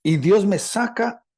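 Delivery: ordinary talk, nothing marked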